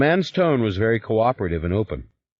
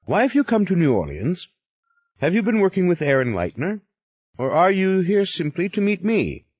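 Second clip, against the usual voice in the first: higher, pressing questions; wary Yuri; 11 semitones higher than usual. Acted. Yuri interrogating the stranger at the airport, suspicious. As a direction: push him for answers.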